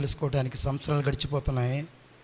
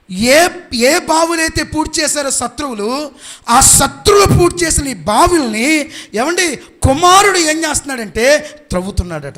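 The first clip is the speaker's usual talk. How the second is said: impassioned preaching; 10 semitones higher, 11 dB louder than usual. Real, not acted. Preacher on wells the enemies filled, loud and high. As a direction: drive each phrase out to the congregation.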